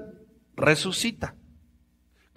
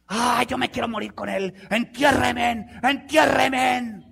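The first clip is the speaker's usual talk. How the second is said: mock wailing mourner; 7 semitones higher, slower than usual. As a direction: drag out the fake grief. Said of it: "hipócritas rasgándose las vestiduras". (plañido burlón, lento y agudo)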